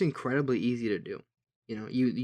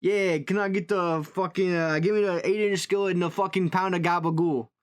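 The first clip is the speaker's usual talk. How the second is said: higher, put-on Italian chef; 6 semitones higher, 4 dB louder than usual. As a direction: order it like a hammed-up Italian chef. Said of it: "if I'm doing the Italian chef voice".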